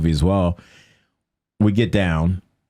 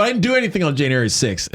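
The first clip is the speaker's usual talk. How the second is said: indignant protest; 9 semitones higher, faster than usual. Retold or imitated. Retold protest during arrest.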